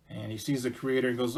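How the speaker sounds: ordinary delivery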